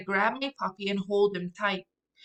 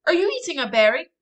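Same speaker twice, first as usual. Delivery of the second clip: higher, louder, fast alarmed questions; 6 semitones higher, 8 dB louder than usual. Acted. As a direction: fire questions in a panic. Alarmed sister questions brother.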